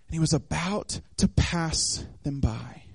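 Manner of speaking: slow, low, softened quote; measured verse reading. A narrator's reading. Preacher quoting the phrase thrown into the story, slow and low. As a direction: space the words out, low and deliberate.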